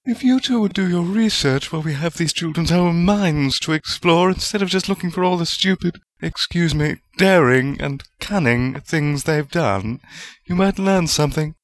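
a touch of tartness, understated; tart chiding. A narrator's reading. Woman chiding two colleagues, mildly tart. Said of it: "Rowena's voice was tart as she chided".

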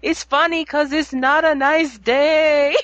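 Fawad's laugh. Retold or imitated. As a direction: chuckle slowly as you explain the joke. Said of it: "And then Fawad goes".

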